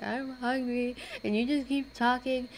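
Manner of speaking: quoting the class complaining, whiny and high; mock whiny student.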